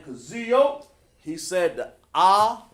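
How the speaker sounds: loud, high praise calling out a student; excited shout-out. Real, not acted. Teacher praising a student in class, excited loud call. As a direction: call out his name with big excitement.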